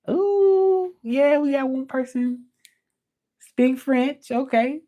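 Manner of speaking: high, squealing with delight; thrilled someone spoke French; excited. Real, not acted.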